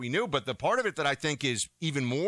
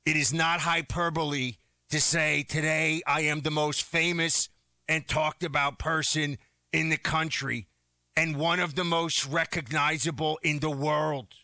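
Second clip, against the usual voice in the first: usual speaking voice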